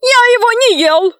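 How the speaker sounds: very high, panicky cry; frantic high-pitched yell; screamed denial. A narrator's reading. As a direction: cry out your innocence, very high. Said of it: "закричал людоед".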